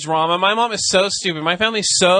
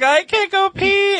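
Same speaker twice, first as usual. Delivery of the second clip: high, slow imitated child's wail; mock crying; slower than usual. Retelling cousin crying in the tub, mock wail. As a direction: wail it like an upset little kid. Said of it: "she started crying".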